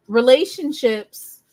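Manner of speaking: ordinary talk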